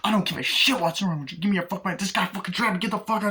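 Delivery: high, loud, fast robber's rant; aggressive threat. Retold or imitated. Robber demanding money in a film retelling, aggressive threat.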